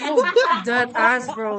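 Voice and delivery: loud, slow words through real laughter; laughing insistence. Real, not acted. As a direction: insist it's true while cracking up. Laughing insisting it's true.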